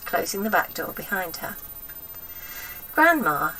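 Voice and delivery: plain reading voice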